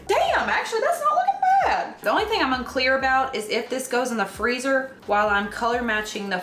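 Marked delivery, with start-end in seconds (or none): 0.1-1.9 much higher, rising amazement; surprised it actually looks good; pleasantly surprised. Real, not acted. Baker surprised by her own cake, amazed.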